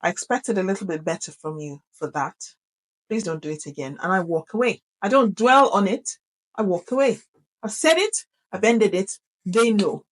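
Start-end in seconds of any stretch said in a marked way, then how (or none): 0.0-4.0 quiet, lower, controlled reproach; low serious telling-off. Shown as a demonstration. Mother who is not in the mood, quiet stern monotone. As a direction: drop the voice and stay level. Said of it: "When I come in and I say".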